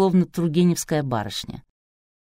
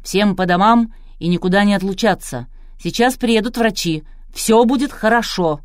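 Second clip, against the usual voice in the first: loud, raised producer's orders; commanding; 5 semitones higher, 6 dB louder than usual. A narrator's reading. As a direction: take charge and order everyone out loudly. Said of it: "продюсер продолжал командовать". (командным тоном, громко)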